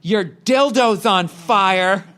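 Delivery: high, slow put-on voice of a snide stranger; mocking passerby. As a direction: imitate the dressed-up guy sneering as he storms past. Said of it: "and he goes".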